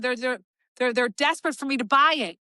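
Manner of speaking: ordinary delivery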